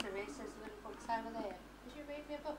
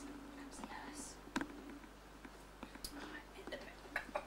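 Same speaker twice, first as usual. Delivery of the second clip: urgent hushed whisper; 3 semitones higher, 9 dB quieter, slower than usual. Pretend. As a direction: whisper so the nurse won't hear. Hiding from pretend nurse.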